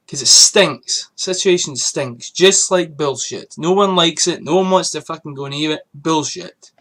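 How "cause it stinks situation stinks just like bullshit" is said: slow, every word punched in disgust; slow emphatic disgust. Real, not acted. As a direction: drag out stinks with contempt.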